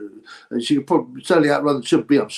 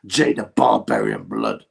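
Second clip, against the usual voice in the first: much lower, slow announcer boom; ring announcer; 14 semitones lower, slower than usual. Pretend. Streamer announcing a viewer's fighter name, like a ring announcer.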